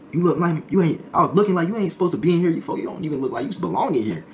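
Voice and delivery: ribbing the new guy; teasing inmate. Retold or imitated.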